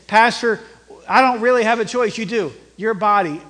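loud, urgent pulpit exhortation; forceful preaching. Acted. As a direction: preach it hard, punching key words.

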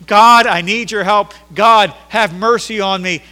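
loud, drawn-out plea for mercy; crying out. Acted. Blind Bartimaeus begging God by the roadside, crying out. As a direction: cry out slowly and forcefully to God. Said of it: "crying out and saying".

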